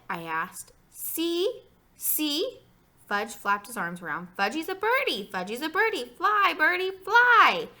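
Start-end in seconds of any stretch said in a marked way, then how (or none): 0.9-2.6 put-on high little-kid voice; high, eager small-child chirp; excited toddler. A narrator's reading. Toddler wants brother to look. 4.4-7.7 put-on high, loud little-kid cries; building small-child squeal, loudest at the end; excited toddler. A narrator's reading. Toddler pretends to be a bird.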